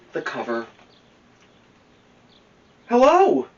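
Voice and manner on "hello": high, loud surprised hello; friendly watchman. Acted. Watchman finds the bear in bed, high loud hello. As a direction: greet the bear brightly.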